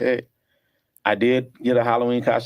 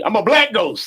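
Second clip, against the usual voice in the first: high, loud mock-proud kid announcement; hammed-up costume reveal; 10 semitones higher, 5 dB louder than usual. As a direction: blurt it out high and loud, playing it for laughs. Announcing homemade Halloween costume as a kid.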